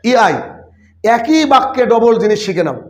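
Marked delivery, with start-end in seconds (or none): none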